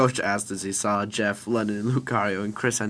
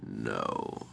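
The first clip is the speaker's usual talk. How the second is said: very low, softer "no"; stern character refusal; 13 semitones lower, 6 dB quieter than usual. Acted. Lucario refuses the child's plea, low and firm. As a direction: drop right down for a refusal.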